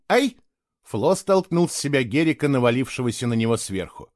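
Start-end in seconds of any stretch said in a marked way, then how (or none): 0.1-0.4 indignant ouch. A narrator's reading. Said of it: "С возмущённым".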